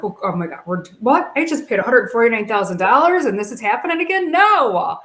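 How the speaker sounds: oh no, not again; panicked disbelief. Retold or imitated. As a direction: sputter it out in mounting shock. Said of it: "I'm like".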